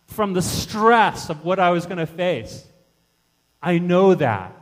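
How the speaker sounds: loud, high-pitched preaching cry; impassioned empathy. Acted. Jesus telling sufferers he was forsaken too, impassioned cry. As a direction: build to full force as Jesus recalling agony. Jesus voiced recalling his own agony.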